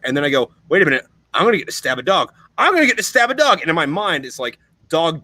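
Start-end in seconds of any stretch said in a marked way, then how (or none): 0.7-3.6 quick glee rising high on the repeat; dawning delight, building to an excited, high-pitched burst; retold giddy realisation. Marine realising he gets to stab a dog, excited. Retelling dread turning into glee.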